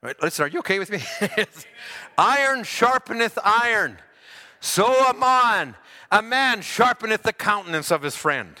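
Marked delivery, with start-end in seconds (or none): 2.2-8.5 slow, lifted reading of the verse; scripture recital. Acted. Preacher intoning a proverb slowly to the congregation.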